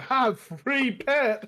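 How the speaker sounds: slow, drawn-out mock child; kid delighted by find. Man mocks child finding dead animal, slow imitated delight. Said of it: "this kid just say".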